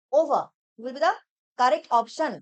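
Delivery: "over" drawn out slowly and firmly; slow emphatic answer. Shown as a demonstration. Teacher announcing the correct option slowly. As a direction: give the answer slowly, word by word. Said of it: "এখানে হবে option C".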